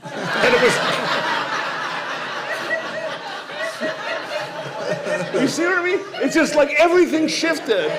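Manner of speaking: high, loud laughter through the words; can barely get it out for laughing; laughing hard. Real, not acted. Telling the host about the punchline, laughing hard.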